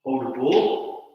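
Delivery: soft, slow calls of each move; calmly name the postures; serene tai chi cues. Acted. Soft cues during form.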